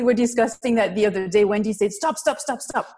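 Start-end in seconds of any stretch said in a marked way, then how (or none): none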